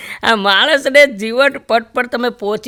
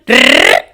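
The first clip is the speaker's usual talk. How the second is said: a low rolling ttrr of a rickshaw engine; engine noise; 7 dB louder, much slower than usual. Retold or imitated. For the rickshaw ride, a low engine rattle. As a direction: mimic the motor sputtering low.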